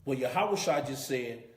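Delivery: usual speaking voice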